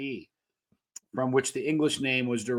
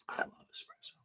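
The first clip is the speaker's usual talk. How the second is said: whisper; 18 dB quieter, faster than usual. Real, not acted. Fond aside about espresso.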